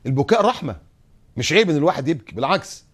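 normal talking voice

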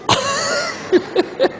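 high burst of laughter; hearty laugh. Real, not acted. Laughing at the story. (ضحكة عالية)

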